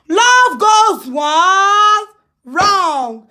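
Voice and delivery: very slow, drawn-out taunt; slow, gloating singsong; mocking drawl. Real, not acted. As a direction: stretch every word out long to jeer. Mocking a failed romance on live.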